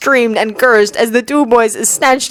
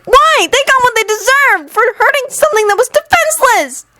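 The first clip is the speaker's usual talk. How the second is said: Anakin insisting angrily to Obi-Wan. Acted. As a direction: push the question harder. Anakin repeating demand louder.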